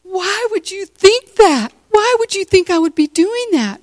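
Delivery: high-pitched, incredulous retort, imitated; mock indignant disbelief. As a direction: act out someone utterly taken aback. Principal retold, taken aback.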